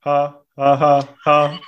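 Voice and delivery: deliberate ha ha laugh; fake laugh. Shown as a demonstration. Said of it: "Kannst du üben".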